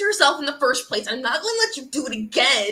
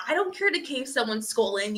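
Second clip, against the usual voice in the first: normal talking voice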